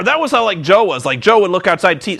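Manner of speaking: ordinary delivery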